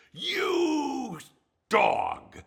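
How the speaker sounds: high, loud mock-furious shout; hammed-up Oliver Hardy indignation; Ollie's outraged shout. Retelling a Laurel and Hardy scene, mock-outraged shout. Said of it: "he suddenly turns on Stanley and he says".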